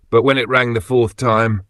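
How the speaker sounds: ordinary delivery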